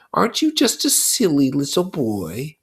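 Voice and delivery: put-on a buzzing devil taunting a child; Lord of the Flies, mocking.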